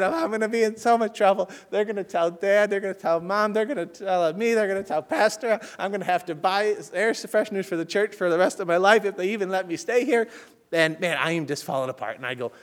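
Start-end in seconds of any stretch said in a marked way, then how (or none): 0.0-10.3 retold crying. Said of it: "I start crying".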